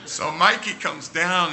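plain reading voice

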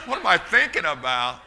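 put-on daydreaming teenager. Preacher imitating his teenage self daydreaming while driving, goofy and giggling. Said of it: "What, what were you thinking about?".

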